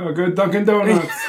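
mock South Boston accent, laughing. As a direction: put on a crazy Boston accent. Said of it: "crazy, like, South Boston".

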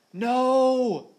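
very loud, high, held wail of protest; anguished full-force yell; bellowing. A narrator's reading. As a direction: bellow it long and desperate. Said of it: "he bellowed as he finally saw it".

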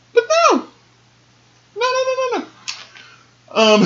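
squeaky high no. Real, not acted. Vlogger squealing no at her viewers. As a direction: shoot way up into a squeal of denial. Mock disbelief, squeaky highs.

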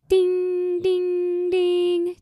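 high sung ding ding ding; playful bell imitation; mock bell ding. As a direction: chime like a quiz bell. Bell sound after time runs out.

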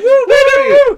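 high, loud mock whoops; Bender whooping. Retelling Bender's cheer, high loud whoops. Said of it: "he goes".

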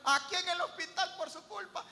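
laughing, high mock accusation; laughing complaint. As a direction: blame him through laughter. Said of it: "le llama y le dice".

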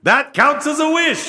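high, loud genie voice. Pretend. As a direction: scold like a sly genie. Genie scene.

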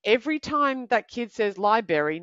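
ordinary talk

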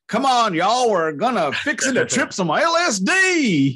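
high, hammed-up Alabama drawl, slowing at the end; Alabama accent. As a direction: belt out a mock Alabama twang. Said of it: "singing in this Alabama accent".

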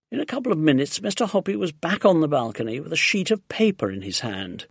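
ordinary delivery

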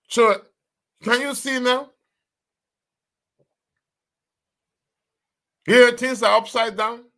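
high, urgent preacher warning; fervent preaching. Acted. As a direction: drive the warning home from the pulpit. Preaching at full force.

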